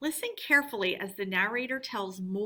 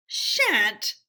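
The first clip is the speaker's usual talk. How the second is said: defiant; 7 dB louder than usual. Acted. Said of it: "replied Toad with great spirit".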